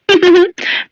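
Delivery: high jeering cackle; mocking laugh. Rich girls laughing at Radha, high jeering cackle. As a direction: laugh in her face.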